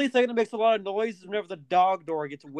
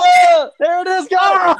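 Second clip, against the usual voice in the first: loud, high triumphant shout; excited shout; 9 semitones higher, 11 dB louder, slower than usual. Real, not acted. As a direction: yell it out in triumph. The scraping sound finally works.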